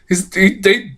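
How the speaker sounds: ordinary talk, nothing marked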